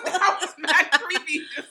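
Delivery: loud, high, laughing exclamation; cracking up loudly; laughing hard. Real, not acted. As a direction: blurt it out through big laughs. Laughing at Quad's creepy moment.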